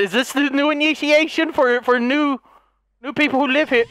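ordinary talk

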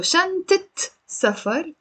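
شنطة as shantit, then سفر; Lebanese kasra ending. Shown as a demonstration. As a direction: sound shan-tit before سفر. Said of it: "the fatha becomes a kasra".

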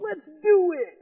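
put-on high, loud excited kid voice; squeaky gung-ho cheer; eager kids. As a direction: pipe it up like thrilled kids. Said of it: "these kids are like".

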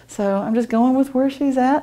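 ordinary talk

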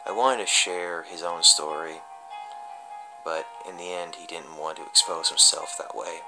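ordinary delivery

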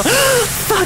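sharp high gasp then curse; startled gasp. Real, not acted. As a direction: jump and swear in fright. Sudden jumpscare.

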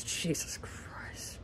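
a hushed, disbelieving curse under the breath; exasperated whisper. Real, not acted. Fan whispering in disbelief at his team's collapse, hushed. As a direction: breathe it out, barely voiced. Fan ranting after a lost game.

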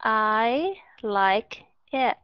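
word by word, slowly, with no linking; slow reading. Shown as a demonstration. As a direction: read it out as for a beginner, each word separate. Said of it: "mình cứ từ từ mình đọc". (đọc từ từ, tách rời từng chữ)

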